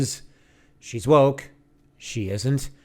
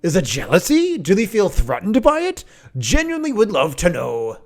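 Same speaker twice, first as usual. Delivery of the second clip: hammed-up Scottish accent, pitched up; Critical Drinker; 4 semitones higher, 4 dB louder than usual. Reading out the Scottish YouTuber's follow-up questions, mock Scottish accent. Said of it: "so he even added".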